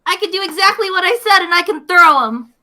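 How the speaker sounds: high-pitched excited quote of herself; thrilled at a great find; really happy. Retold or imitated. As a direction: retell it with gleeful, rising excitement. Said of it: "I was really happy".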